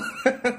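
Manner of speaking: high falling laugh; short laugh. Real, not acted. Host laughs at his own Taiwanese joke, a short burst. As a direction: let a quick laugh drop.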